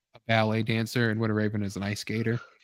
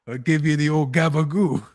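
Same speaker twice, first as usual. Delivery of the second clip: thick New York Italian voice; Queens accent; 2 semitones higher, 4 dB louder than usual. Pretend. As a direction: lean hard into the gabagoo. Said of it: "a Queens accent".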